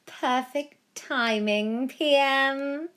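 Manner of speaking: slow, gloating witchy cackle; cackling villain. A narrator's reading. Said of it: "Violetta cackled".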